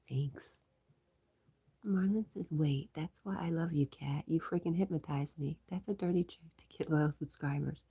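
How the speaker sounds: hushed ASMR-like murmur; whisper. Real, not acted. Streamer whispers chat messages to viewers.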